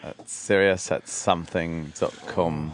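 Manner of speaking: slow careful read-back. Shown as a demonstration. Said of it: "Please read that back".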